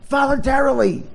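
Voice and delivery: high, exclaimed disbelief; incredulous. Real, not acted. Comic repeats the word in outrage over a star caving, incredulous.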